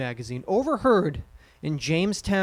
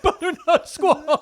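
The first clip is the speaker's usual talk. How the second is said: high, slow, laughing through the words; 11 semitones higher, 5 dB louder, slower than usual. Real, not acted. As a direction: giggle out the punchline. Repeats punchline laughing.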